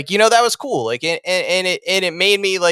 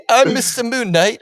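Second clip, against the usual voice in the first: put-on high-pitched comic British accent; exaggerated British character impression; Stephen Grant; 8 semitones higher than usual. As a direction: introduce yourself as a silly high-voiced Englishman. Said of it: "that's how he sounded to me".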